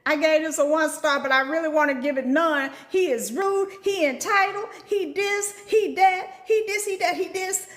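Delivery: high, sassy mocking review voice; mock reviewer. Mimicking a customer reading her one-star review. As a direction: rattle off the complaints in a high mocking tone.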